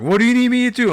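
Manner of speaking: high, loud mocking voice of the helper; mock pushy helper. Mocking the helper who won't let go, loud and high. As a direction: squeak it out like an overeager busybody. Helper repeating himself again.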